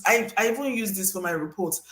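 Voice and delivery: ordinary delivery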